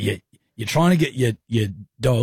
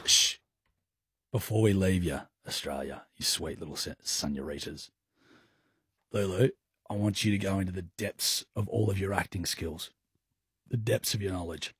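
hushed; 5 semitones lower, 9 dB quieter than usual. Acted. Hushed dramatic setup for acting.

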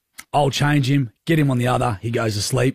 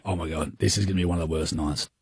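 much softer, hushed dread; weary dread; 8 semitones lower, 7 dB quieter, faster than usual. Retold or imitated. Dad retelling a long night with the crying baby, hushed dread. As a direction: mutter it like you're already exhausted. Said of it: "We're just going".

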